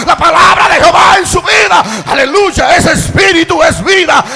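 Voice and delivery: loud, high-pitched preaching shout; full-force preaching. Real, not acted. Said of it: "Le predico así". (prédica a gritos, con fervor)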